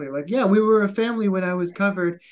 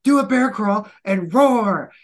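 loud, slow barked command; drill sergeant order; 5 semitones higher, 7 dB louder, slower than usual. Retold or imitated. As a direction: bellow the order across the formation. Drill instructors yelling at recruits.

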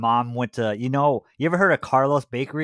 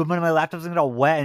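ordinary talk

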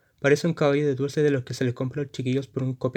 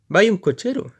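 queja; 7 semitones higher, 6 dB louder than usual. Acted. Militar se queja.

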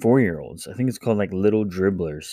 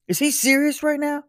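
put-on higher indignant kid voice, odd timbre. Older son protests to dad, high and indignant. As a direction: repeat the outrage as the big brother.

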